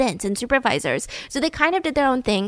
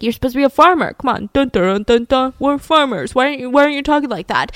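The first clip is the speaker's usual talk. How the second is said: gruff teasing farmer voice, faster at the end; mocking farmer bully; 3 semitones higher than usual. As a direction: taunt him like a tough farm kid. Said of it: "They're like".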